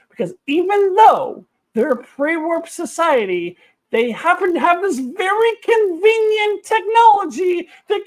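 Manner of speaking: high, loud, indignant ranting; exasperated rant. Real, not acted. Reviewer fuming at the episode's plot, loud exasperated rant. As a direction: vent the outrage at full pitch.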